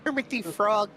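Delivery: light frog puppet voice; a hint of Kermit. Shown as a demonstration. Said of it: "give it your best Kermit the Frog voice".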